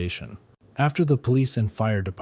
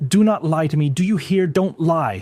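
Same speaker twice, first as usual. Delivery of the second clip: higher, louder furious yelling; loud scream; 8 semitones higher, 7 dB louder than usual. A narrator's reading. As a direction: scream at him not to lie. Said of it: "sister screamed loudly".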